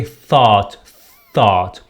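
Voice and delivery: "Thought" with the tongue out on th. Shown as a demonstration. Teacher showing students the tongue position, tongue-out th. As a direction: repeat "Thought" singly, falling, tongue between teeth. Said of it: "نخرج لساننا".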